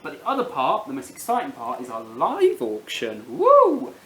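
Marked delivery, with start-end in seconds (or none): 3.4-3.9 high loud whoop; excited whoop. Real, not acted. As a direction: cheer it out. Cheering the live auction.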